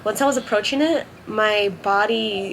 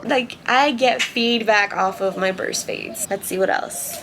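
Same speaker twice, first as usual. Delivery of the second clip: ordinary talk, nothing marked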